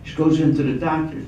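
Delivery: ordinary talk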